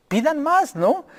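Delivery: high, eager imitation of dad; dad urging.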